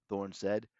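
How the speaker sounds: plain reading voice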